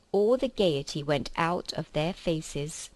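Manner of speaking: plain reading voice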